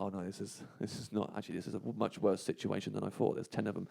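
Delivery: low, quiet inner monologue; sinking realisation. Retold or imitated. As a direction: retell a private dawning dread, softly. Retold dawning fear, inner thoughts.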